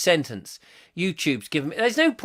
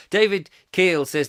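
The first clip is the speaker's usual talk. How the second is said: ordinary talk, nothing marked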